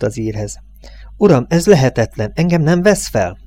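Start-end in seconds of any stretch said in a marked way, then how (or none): 1.2-3.4 higher, pleading disbelief; mildly imploring, rising in pitch; gentle insistent begging. A narrator's reading. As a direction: beg the boss to reconsider. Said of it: "de mégis tolakodó könyörgést".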